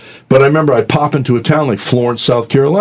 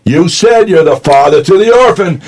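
raised voice; 8 semitones higher, 5 dB louder than usual. Retold or imitated. Pleading with God, raised voice.

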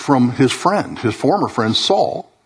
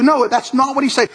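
usual speaking voice

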